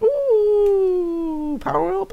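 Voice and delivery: very high squeaky hyper voice; squeaky excited brain. Retold or imitated. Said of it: "reminds it of something that's like".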